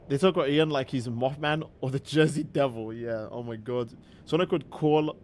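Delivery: ordinary talk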